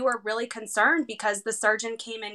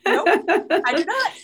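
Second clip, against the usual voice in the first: laughing giddy nope, higher and louder; 7 semitones higher, 4 dB louder, slower than usual. Retold or imitated. Mom laughing to the surgeon, still in shock, giddy laugh. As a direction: giggle it out in disbelief. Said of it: "And I'm like".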